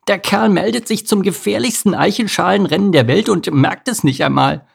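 high-pitched, scoffing cricket voice; mocking cricket. A narrator's reading. Cricket jeering at Bodo before the race, mocking.

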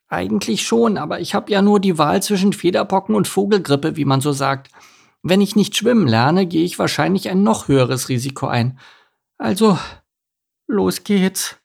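high little duck talking himself into it; a duckling summoning courage; high, hesitant young duck; nervous duckling. Acted. Enno voices his plan.

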